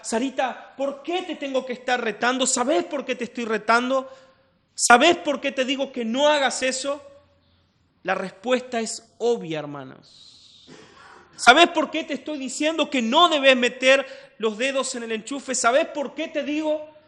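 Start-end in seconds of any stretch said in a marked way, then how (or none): 0.0-7.0 higher, louder scolding father; father scolding Sarita. Retold or imitated. Father scolds his daughter Sarita, mock stern. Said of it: "Es como cuando Pato le dice a Sarita". 11.3-16.9 higher, louder scolding father; father scolding Sarita. Retold or imitated. Father scolds his daughter Sarita, mock stern. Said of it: "Pato le dice a Sarita".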